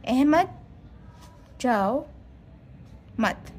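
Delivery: long pauses after "Ahmad" and "जाओ"; word-by-word pauses. Shown as a demonstration. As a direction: spell out the breaks between "Ahmad", "जाओ", "मत". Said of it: "जहाँ ज़रूरत हो वहाँ सक्ता का निशान".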